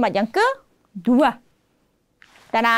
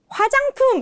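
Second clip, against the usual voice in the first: 화장품 called out high; announcing new word; 12 semitones higher, much faster than usual. Shown as a demonstration. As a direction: present 화장품 brightly. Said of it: "new word shown".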